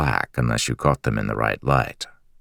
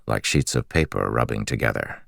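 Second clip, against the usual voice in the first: ordinary delivery, nothing marked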